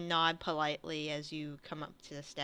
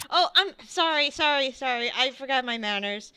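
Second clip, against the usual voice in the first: raised, loud apology easing down; hasty apology; 5 semitones higher, 5 dB louder, faster than usual. Acted. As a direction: blurt out sorry, then settle. Character hastily apologises for manners.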